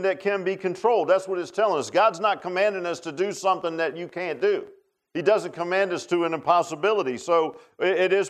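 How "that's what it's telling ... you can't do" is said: ordinary talk, nothing marked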